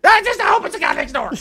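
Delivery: hammed-up high voice of a nervous neighbor; imitating the scared neighbor. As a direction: voice the neighbor praying it's only the guy next door. Neighbor hoping it's someone safe.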